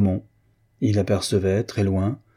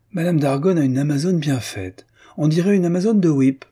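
higher, louder enthusiastic praise; admiring exclamation; 7 semitones higher, 4 dB louder than usual. A narrator's reading. Morière exclaiming over the habit, enthusiastic. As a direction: gush over the riding habit. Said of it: "Morière poussa une exclamation".

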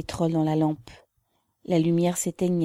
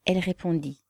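usual speaking voice

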